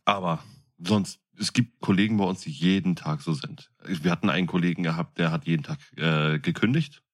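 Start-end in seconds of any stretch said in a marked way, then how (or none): none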